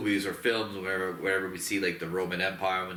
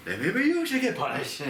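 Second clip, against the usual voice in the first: far higher sudden menace; mock villain threat; 13 semitones higher, 3 dB louder than usual. The Scar King turns on the pleading ape, a high mock threat. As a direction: spike it up into a cartoon threat. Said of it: "And he's like".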